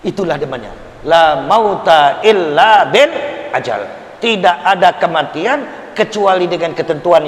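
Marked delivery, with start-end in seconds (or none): 1.0-3.8 Arabic recitation. Acted. Quoting an Arabic hadith.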